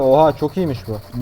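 ordinary talk, nothing marked

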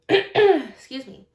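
slow, loud pointed throat-clearing excuse me. Acted. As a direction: draw attention with a pointed excuse me. Crenshaw demands attention politely.

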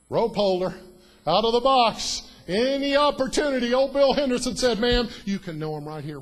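loud, high exclamation; preaching at full force. Acted. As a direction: shout it out with fire.

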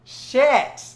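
high, loud curse; sudden loud expletive; dismayed outburst. Real, not acted. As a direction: blurt it out at your own slip. Realises she forgot about Toto.

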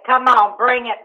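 ordinary talk

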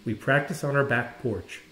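plain reading voice

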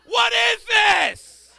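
slow, high, loud shout; mock outrage. Comedian mocking his dying parents, slow shout. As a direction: stretch it out in comic disbelief.

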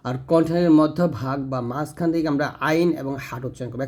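ordinary delivery

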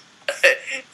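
loud high laughter; can't stop laughing. Real, not acted. Still laughing at the friend, loud high laugh.

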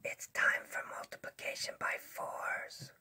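very soft, voiceless puppet whisper. Pretend. SpongeBob secretly announces the lesson to the teacher, whispering. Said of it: "why are we whispering?".

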